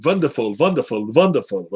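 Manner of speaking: "wonderful" with a German V, vonderful; lightly mock German accent, repeated. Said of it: "Do my German accent".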